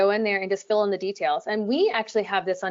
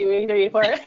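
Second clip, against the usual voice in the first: loud rapid-fire auction call; auctioneer voice; 6 dB louder, much faster than usual. Shown as a demonstration. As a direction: rattle off bids like an auctioneer. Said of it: "doing like an auctioneer".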